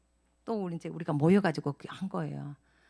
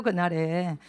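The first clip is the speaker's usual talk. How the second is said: normal talking voice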